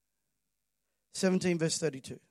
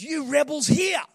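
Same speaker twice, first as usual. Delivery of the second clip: a higher, louder shout at the rebels; retold angry shout; 6 semitones higher, 3 dB louder, slower than usual. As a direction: bark it at the crowd like furious Moses. Moses yells at the people.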